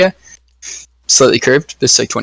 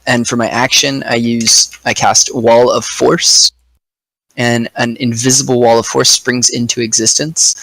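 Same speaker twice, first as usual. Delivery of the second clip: usual speaking voice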